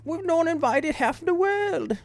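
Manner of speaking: very high, drawn-out British caricature; slow, shrieky mock-British falsetto; mock British accent. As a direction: squawk it as a silly Brit. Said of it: "Just B-, Britain in general".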